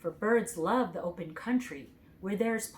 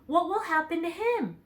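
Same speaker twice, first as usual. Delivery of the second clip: higher, worried plea; anxious; 9 semitones higher, 3 dB louder than usual. A narrator's reading. Dorothy worries to the Tin Woodman about Toto. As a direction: ask afraid for her dog. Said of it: "said the girl anxiously".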